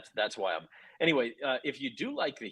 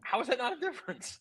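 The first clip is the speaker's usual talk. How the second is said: loud, high-pitched protest; raised voice, pitched way up in disbelief; heated retort; 12 semitones higher, 6 dB louder than usual. Real, not acted. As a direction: fire back over the other speaker, incredulous. Shouting back in heated argument.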